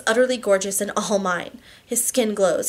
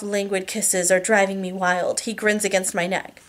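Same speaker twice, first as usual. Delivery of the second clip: ordinary delivery, nothing marked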